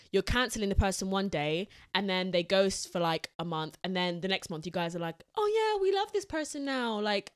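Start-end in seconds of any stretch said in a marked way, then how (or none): 5.4-7.0 high-pitched fake adoration; mocking fan voice, much higher; mock gushing. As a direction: gush like a fickle fan, pitched way up. Mocking fickle online fans.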